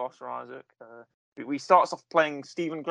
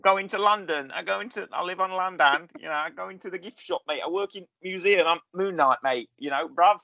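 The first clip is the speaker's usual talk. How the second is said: higher-pitched hammed-up Cockney geezer; a lightly put-on Cockney lad, higher up; mock Cockney accent; 3 semitones higher than usual. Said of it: "That's how he sounds".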